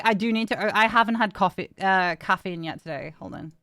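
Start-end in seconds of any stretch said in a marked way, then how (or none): none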